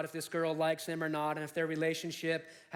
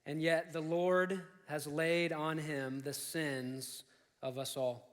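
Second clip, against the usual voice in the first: slow, measured verse reading; scripture reading; 4 semitones lower, slower than usual. Acted. Preacher reading a verse to the congregation, slow scripture reading.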